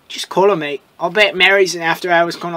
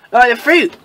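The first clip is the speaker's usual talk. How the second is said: ordinary delivery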